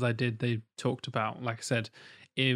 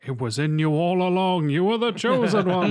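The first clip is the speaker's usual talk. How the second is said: loud, high, hammed-up revelation; 7 semitones higher, 5 dB louder than usual. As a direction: proclaim it like a mock chosen-one prophecy. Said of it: "almost like a really stereotypical".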